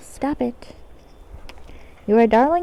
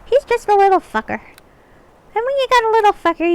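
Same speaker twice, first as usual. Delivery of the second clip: a hint of pixie, slow and a bit higher; lightly voiced pixie; 6 semitones higher, slower than usual. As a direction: ease into a light fairy voice. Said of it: "you want the pixie voice?".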